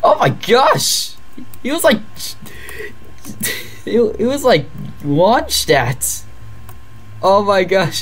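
shocked excitement. Real, not acted. Watching a unit get launched, she exclaims in delighted shock.

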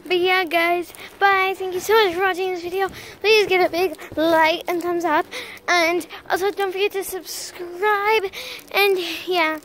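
bouncing while talking; on a trampoline. Real, not acted. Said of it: "we're on the trampoline right now".

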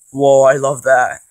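ordinary delivery

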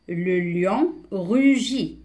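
very slow, drawn-out teacher's reading; deliberate, word-by-word reading; slow model reading. Shown as a demonstration. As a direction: stretch each word for beginning readers. Slow model reading, later explained.

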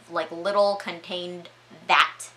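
ordinary delivery

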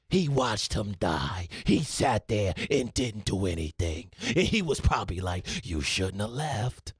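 suddenly low, hushed pundit gravity; Stephen A Smith. Acted. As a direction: drop way down and let each phrase sink. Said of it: "Stephen A Smith".

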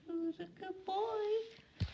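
very high, slow, soft dog praise; squeaky praising a dog. Real, not acted. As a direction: coo it up high at the pup.

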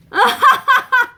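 high loud burst of laughter. Real, not acted. Teacher laughing loudly at her own joke.

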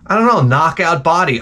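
ordinary talk, nothing marked

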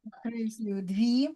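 hushed, voiceless murmur over the notebook; whispered aside. Real, not acted. Teacher whispering to herself while marking letters. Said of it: "teacher murmuring while writing".

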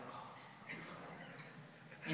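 hushed, slow, puzzled whisper; stuck whisper. Retold or imitated. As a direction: whisper it slowly as if lost for the verse. Classmates stuck on recall.

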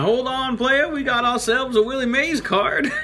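high, hyped-up showman call with laughter; excited hype voice. Acted. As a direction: shout out a big pull. Excited card pull.

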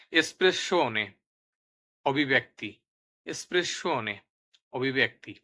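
loud, emphatic word-by-word dictation with falling endings; vocabulary drill. Shown as a demonstration. Teacher has learners repeat Italian words with Bengali meanings, emphatic drill.

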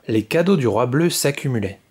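ordinary delivery, nothing marked

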